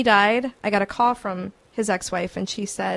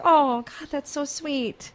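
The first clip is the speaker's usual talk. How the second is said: touched; 6 semitones higher, much slower than usual. Retold or imitated. Host recalling being moved by the psychic's message, touched. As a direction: melt at the sweet message. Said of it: "I'm like".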